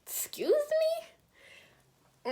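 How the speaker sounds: high rising disbelief on excuse; a sharp rising incredulous question. Retold or imitated. To the couple at the window, high incredulous.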